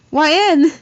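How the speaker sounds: high, loud desperate yell of the name; high loud shout. Acted. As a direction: call out to her as she's dragged away. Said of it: "Helen yelled".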